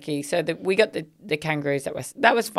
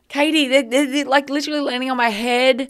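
higher, rushing with disbelief; excited; 7 semitones higher, 4 dB louder than usual. Real, not acted. Telling Katie about birds landing on her head, excited. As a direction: spill it out amazed.

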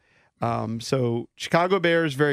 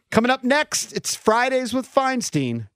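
announcer promo; 10 semitones higher than usual. Acted. Host reads show promo.

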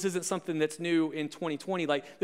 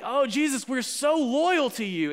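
gushing devotion, higher, slowing on the pledge; hammed-up pledge of loyalty; emphatic, emotional; 6 semitones higher, slower than usual. Believers professing loyalty to Jesus, emphatic and emotional. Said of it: "emphatic and emotional".

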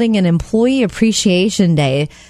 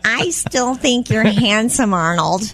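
lightly voiced goose, slow and sweet; a hint of a doting goose wife. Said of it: "Is that your goose voice?".

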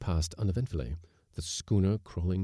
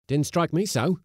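careless; 10 semitones higher, 7 dB louder than usual. A narrator's reading. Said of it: "said the mate carelessly".

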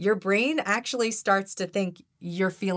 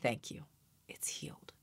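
hushed, much softer whisper at the end; whispered close; 6 semitones lower, 10 dB quieter than usual. Shown as a demonstration. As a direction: breathe it out like the healing is done. Closing the healing prayer softly.

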